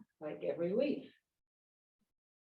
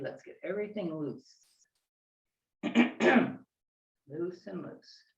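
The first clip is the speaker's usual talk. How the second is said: serene tai chi cues; 4 semitones lower than usual. Acted. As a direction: guide the movement gently.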